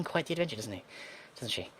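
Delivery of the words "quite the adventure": higher, sing-song quoting voice; imitating her phrase. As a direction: parrot her pet phrase lightly. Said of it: "She likes saying".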